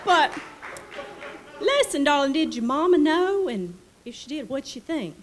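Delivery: high-pitched delighted Delta lady; Southern lady voice. Retold or imitated. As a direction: play the gushing Mississippi hostess. Charlotte asks about mama.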